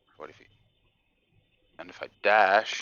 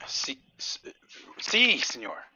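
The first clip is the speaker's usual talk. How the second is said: put-on Spanish accent; 5 semitones higher than usual. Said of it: "Very Spanish".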